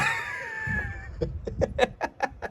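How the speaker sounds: long high-pitched laugh. Real, not acted. Vlogger laughing at his own parody, high and long. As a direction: break into a squealing laugh.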